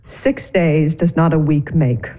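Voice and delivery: low, slow, weighty pronouncement; pompous retort. Acted. Wife answering husband about the honeymoon, low and pompous. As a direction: deliver it like a solemn verdict.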